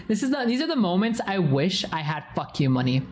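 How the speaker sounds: ordinary talk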